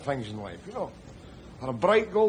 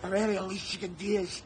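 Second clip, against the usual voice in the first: desperate urging; 8 semitones higher than usual. Acted. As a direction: shake him awake with your voice. Trying to revive rescued man.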